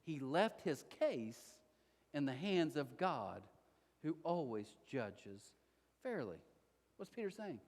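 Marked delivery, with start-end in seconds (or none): 0.0-6.4 slow scripture reading. Acted.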